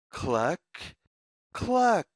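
plain reading voice

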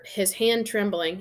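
plain reading voice

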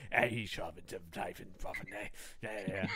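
low, soft Cockney gangster mumble; lightly voiced unintelligible London hard man; garbled British gangster. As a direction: mumble British gangster gibberish under your breath. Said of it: "they can't. Everything is just".

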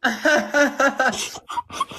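long, high-pitched laugh; high laughing fit. Real, not acted. Host laughs at guest's wig teasing, high laugh. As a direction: laugh helplessly at being teased.

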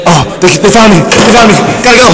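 high, laughing alarm; caught, half-laughing panic; excited panic. Real, not acted. Spotted by the subjects.

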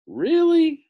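very high, loud incredulous squeal; Scarecrow. A narrator's reading. Scarecrow challenges the confessing man, high loud disbelief. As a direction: shoot the pitch right up on the question. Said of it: "said the Scarecrow".

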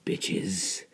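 usual speaking voice

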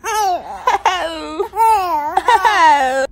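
tearful wail over a loud cry. Real, not acted. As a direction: voice breaking into a cry. Crying baby scene, voices.

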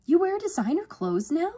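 very high, loud, excited question; amazed. A narrator's reading. Sister finding designer clothes in a box, amazed. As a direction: squeal the question in delight.

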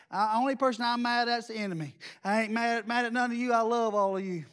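normal talking voice

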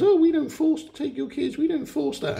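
prissy high falsetto parody of the Jedi; mocking high voice. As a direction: send up their claim in a squeaky voice. Mocking the Jedi's claims.

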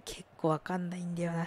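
ordinary talk, nothing marked

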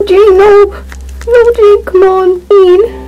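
high, loud, tearful pleading; anguished crying shout. Acted. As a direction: cry out, refusing to let her go. Jane dying, anguished shouting.

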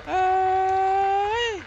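very high loud shout. Real, not acted. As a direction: yelp it out, very high. High protest call.

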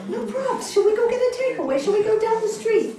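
high, loud, fast happy offer; cheerful husband. Retold or imitated. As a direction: mimic the husband's eager relief. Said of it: "when I told him that".

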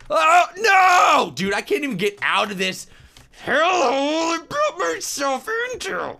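high loud panicked yelling; panicked shout. Real, not acted. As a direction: yell in real frustration. Stuck in game, panicking.

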